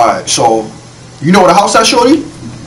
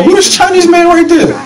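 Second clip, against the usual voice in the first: startled shout. Real, not acted. Player spots a stranger in the game, shouting high and startled. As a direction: shout in sudden alarm.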